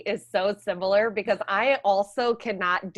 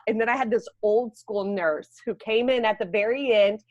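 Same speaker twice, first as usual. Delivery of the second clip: normal talking voice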